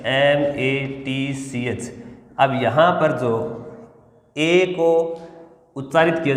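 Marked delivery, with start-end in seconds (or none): 0.1-1.9 letters drawn out in a slow sing-song; slow spelling. Shown as a demonstration. Teacher chanting the spelling to the class, slow sing-song.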